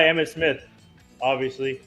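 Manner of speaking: ordinary talk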